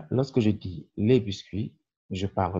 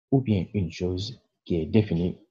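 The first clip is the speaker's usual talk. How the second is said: ordinary delivery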